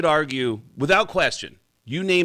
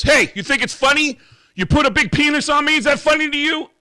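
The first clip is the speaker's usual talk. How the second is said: high, loud mock outrage; angry drummer; 8 dB louder than usual. Drummer confronting the tattoo shop on the phone, high loud outrage. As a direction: yell it up high like a wronged customer.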